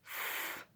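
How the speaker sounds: ف as a long voiceless f hiss; f sound. Shown as a demonstration. Teacher demonstrating the PH sound, a long ف hiss. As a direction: breathe out ف through teeth and lip. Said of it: "زي الفاء في العربي".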